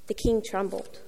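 normal talking voice

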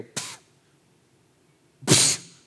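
dismissive scoffing puff of air; scoffing pfft. Retold or imitated. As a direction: blow out a scornful pfft. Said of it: "they go".

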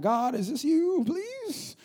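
high, drawn-out plea to God; hammed-up desperate prayer; mock pleading. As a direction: beg in an exaggerated high voice, stretching the words. Acts out a hesitant prayer.

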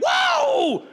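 very high, loud, drawn-out whoa; playful yelp of a thrilled baby; excited baby. Acted. Baby leaping in the womb, high excited shout. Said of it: "this baby's like".

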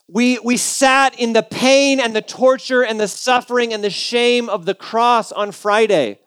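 ordinary talk

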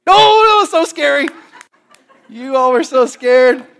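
high, loud, hammed-up gasp of fright; mock horror. Preacher teasing the congregation about Amazon, hammed-up fright. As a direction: tease them with fake terror.

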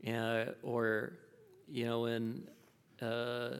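ordinary delivery

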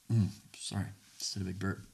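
ordinary talk

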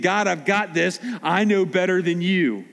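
high, pushy mock defiance toward God; defiant self-talk. A sinner telling God it's my life, in mock defiance.

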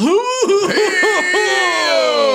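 loud high howl of laughter; loud laughing scream. Real, not acted. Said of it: "that was really good".

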